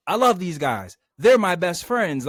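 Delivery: imitating over-earnest devotion; mock gushing. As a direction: gush it like a corny hero. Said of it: "it wasn't on some, like".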